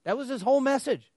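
incredulous outburst. Real, not acted. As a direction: repeat it in disbelief. Speaker's own disbelief.

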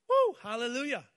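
loud, high whoop of praise; joyful cheer. Acted. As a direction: shout it out like a happy worshipper. Preacher cheers praising God.

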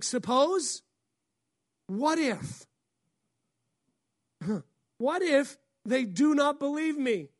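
loud, high, pleading whine; exasperated protest. Retold or imitated. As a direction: protest loudly like a cornered man. Moses voiced protesting.

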